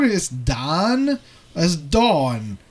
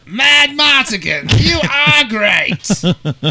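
slow, high, louder drawn-out voice; movie character impression; 8 semitones higher, 6 dB louder, much slower than usual. Recalling the movie, drawn-out high impression. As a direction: stretch it out like a hammy fantasy hero. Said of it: "I haven't done that in a while".

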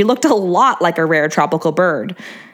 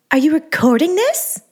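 high breathy voice; 8 semitones higher than usual. A narrator's reading. Angeline's voice again.

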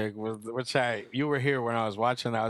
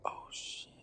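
imitated hushed "oh shit" under the breath; voiceless mock-scared whisper; whispered alarm; 15 dB quieter than usual. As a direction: whisper it as if just realizing the danger. Voices the friend's frightened realization.

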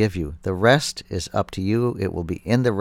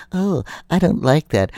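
mock complaining listener; 8 semitones higher, 4 dB louder than usual. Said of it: "They go".

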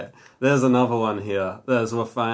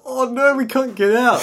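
put-on high panicked kids; 14 semitones higher, 5 dB louder than usual. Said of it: "they're like".

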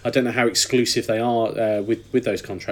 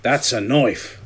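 Triple H impression; 4 dB louder, much slower than usual. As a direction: ham up a wrestling boss. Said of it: "it's a terrible Triple H impression".

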